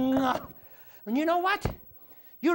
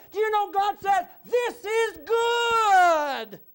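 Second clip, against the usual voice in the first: rising shout, stretched on good; preaching at full force; 4 semitones higher, 4 dB louder, much slower than usual. Acted. Preacher shouting God's words to the church. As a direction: proclaim it to the congregation.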